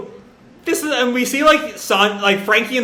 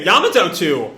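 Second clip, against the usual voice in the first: ordinary delivery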